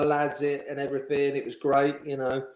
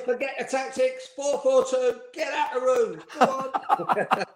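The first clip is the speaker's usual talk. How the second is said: high-pitched fed-up order with a laugh at the end; exasperated manager; 12 semitones higher, slower than usual. Retold or imitated. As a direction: throw the lads out, half laughing. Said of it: "He said".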